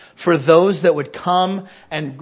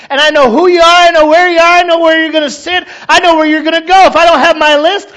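much higher, louder fervent preaching; preaching at full force; 12 semitones higher, 13 dB louder than usual. Real, not acted. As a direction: preach it with rising fervour.

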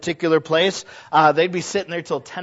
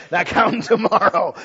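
amused, laughing comeback; 3 semitones higher, 4 dB louder than usual. Retold or imitated. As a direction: repeat it with a laugh in the voice. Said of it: "I go".

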